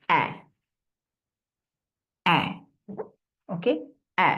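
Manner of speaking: short open অ্যা; short a drill; short a demo. Shown as a demonstration. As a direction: say অ্যা clipped like in cat. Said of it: "short এ সাউন্ডটা কি?".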